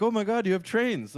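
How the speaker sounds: high-pitched mock British tourist; bad British accent. British couple amazed at American trains, hammed-up British accent. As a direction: gush in a badly faked, high British accent. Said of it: "like, a little British couple coming in".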